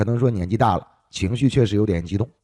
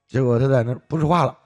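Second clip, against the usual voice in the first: ordinary delivery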